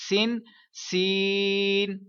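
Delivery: سین drawn out with a whistling hiss; teacher makes سین hiss; whistled s. Shown as a demonstration. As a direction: let سین whistle, slow and long. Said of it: "سین پر بھی سٹی نکالوں گا".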